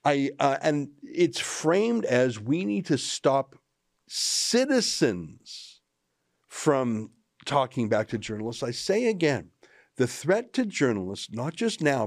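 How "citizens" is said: citizens stretched very slow and high; drawn-out stress. Real, not acted. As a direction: lean hard on citizens, drawing it out. Commentator stresses key word.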